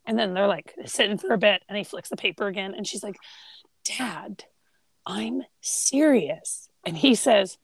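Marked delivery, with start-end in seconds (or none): 3.8-6.7 mock whisper. Daughter whispering in library.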